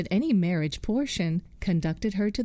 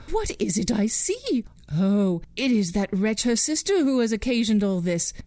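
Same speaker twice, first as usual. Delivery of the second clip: angry mother; 3 semitones higher than usual. Acted. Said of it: "cried the mother".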